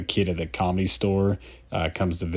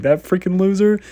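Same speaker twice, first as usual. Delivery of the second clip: much higher, louder mock sneer; sneering insult; 11 semitones higher, 5 dB louder than usual. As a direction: toss it off like a snide jerk. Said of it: "And he's like".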